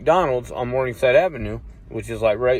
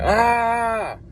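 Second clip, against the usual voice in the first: a sudden high scream of fright; retold scream; 10 semitones higher than usual. Held at gunpoint, he screams in fright. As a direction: yelp out in panic at the gun.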